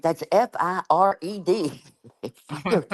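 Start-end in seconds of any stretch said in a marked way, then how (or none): 0.0-1.8 "F I R E D" spelled letter by letter, slow and high. Retold or imitated. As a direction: spell out "F I R E D" slowly, each letter on its own. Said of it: "I'm like".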